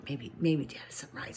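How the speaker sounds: breathless secret whisper; whispered thought. Acted. Boy's secret thought at night.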